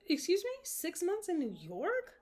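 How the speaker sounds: high-pitched mock indignation; indignant. Girlfriend answering boyfriend's New York plan, indignant. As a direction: act out an affronted comeback. Voices Joan's reply to boyfriend.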